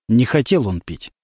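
plain reading voice

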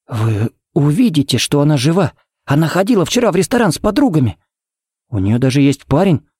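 high, pleading protest; desperate insistence. Acted. Father insisting his daughter is alive, desperate and high. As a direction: insist against the news, pitch climbing.

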